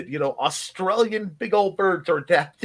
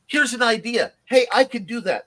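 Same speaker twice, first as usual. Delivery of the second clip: higher-pitched imitation of eager chat members; mock-eager, raised voice; eager chatter; 7 semitones higher, 4 dB louder than usual. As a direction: pipe up like a keen volunteer. Voicing eager people in chat.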